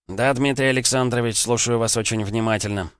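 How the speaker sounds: usual speaking voice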